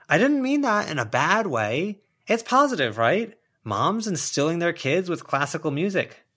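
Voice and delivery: high, loud, hurried excuse; defensive backpedal. Acted. Sharon excusing her remark to Clementine, high and loud. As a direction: talk fast to cover the gaffe.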